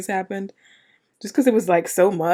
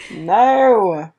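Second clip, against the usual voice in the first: alarmed protest; 7 dB louder than usual. Real, not acted. Reacting to a scary scene.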